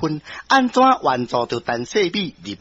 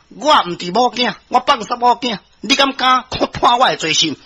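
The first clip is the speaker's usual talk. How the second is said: higher-pitched, indignant defiance; defiant challenge; 6 semitones higher than usual. Acted. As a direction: dare the judge to convict you. Accused nobleman defies judge. (駙馬受氣挑戰判官)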